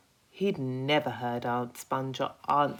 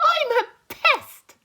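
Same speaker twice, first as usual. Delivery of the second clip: much higher, loud proud boast; grinning pest; 12 dB louder than usual. Acted. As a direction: announce it with a broad grin. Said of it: "the Centipede announced, grinning broadly".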